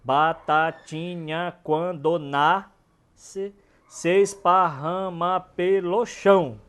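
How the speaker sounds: each syllable drawn out very slowly to count the metre; slow scansion. Shown as a demonstration. Teacher scans the verse to show its metre, very slow. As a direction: stretch out every syllable of "Batatinha, quando nasce". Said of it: "Pode olhar que a métrica tá perfeita".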